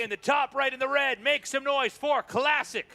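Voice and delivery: loud, high, drawn-out player intro; hype announcer. Acted. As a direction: call the player out like a ring announcer.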